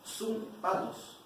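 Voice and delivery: sum, patos spelled out slowly, each part apart; slow syllables. Shown as a demonstration. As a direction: break sum, patos into its two Greek roots, slowly. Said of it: "un poco rara la palabra".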